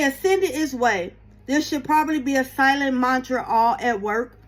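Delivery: normal talking voice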